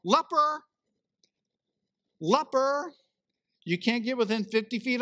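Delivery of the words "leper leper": loud, high cry of "Leper!"; calling out a warning. Retold or imitated. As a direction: shout it to warn the crowd away. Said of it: "you're supposed to yell".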